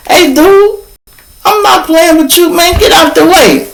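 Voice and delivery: high-pitched, insistent demand; retold heated standoff; confrontational warning. Retold standoff, ordering him to move.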